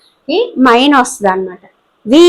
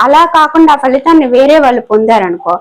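ordinary talk, nothing marked

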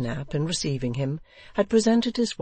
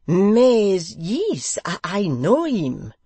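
put-on louder, higher French-accented halting reply; 6 semitones higher, 8 dB louder, much slower than usual. A narrator's reading. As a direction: answer eagerly in French English. French guest answering.

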